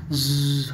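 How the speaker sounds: long hissed "zzz" of wind; wind sound. Shown as a demonstration. Teacher acting out the storm from King Lear, imitated wind sound. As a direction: imitate the wind with a drawn-out buzzing hiss. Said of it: "हवा की sound हो रही है".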